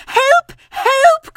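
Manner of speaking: very high, loud squeal for help; panicked cry. Acted. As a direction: scream for help in terror. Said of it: "cried Piglet".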